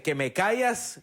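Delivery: challenging taunt. Retold or imitated. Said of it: "she said".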